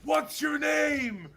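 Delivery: shouted quote. Retold or imitated. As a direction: bellow the question in a put-on voice, very slowly. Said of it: "You know that, that video, it goes".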